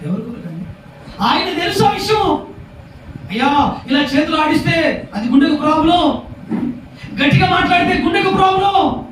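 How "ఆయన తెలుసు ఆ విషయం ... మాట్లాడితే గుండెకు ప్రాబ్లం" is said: high, strained preacher's cry; urgent preaching shout. Real, not acted. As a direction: call the warning out urgently. Preacher voicing warning about heart problem. (ఆందోళనగా గొంతెత్తి హెచ్చరిక)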